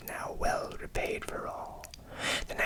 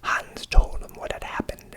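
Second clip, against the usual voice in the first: ordinary delivery, nothing marked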